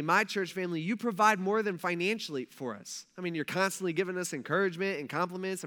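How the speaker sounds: ordinary talk, nothing marked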